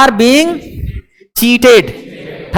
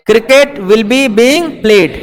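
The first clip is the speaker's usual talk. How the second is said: slow dictation; much slower than usual. Shown as a demonstration. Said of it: "teacher dictates future passive".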